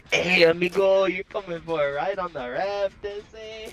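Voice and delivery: hammed-up high Mexican accent. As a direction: play a jokey Mexican bandit, amigo. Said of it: "we'd speak in a Mexican accent".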